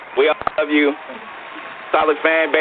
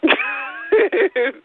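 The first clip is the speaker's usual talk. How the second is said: high burst of laughter. Real, not acted. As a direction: crack up, high-pitched. Host laughs at answer.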